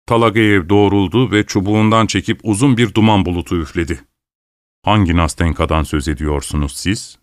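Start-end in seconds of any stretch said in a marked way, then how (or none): none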